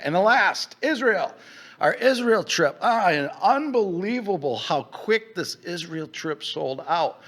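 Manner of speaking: high, loud excited announcement. Real, not acted. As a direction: burst out with excitement.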